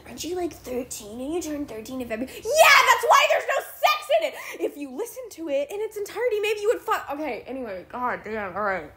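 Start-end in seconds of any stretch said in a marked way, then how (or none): 2.3-4.3 loud, higher laughing retort; laughing shout. Real, not acted. Laughing shout back at the commenter.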